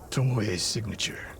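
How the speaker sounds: hushed whisper in enemy territory. Acted. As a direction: whisper it so no one in the camp hears. Sneaking through enemy camp.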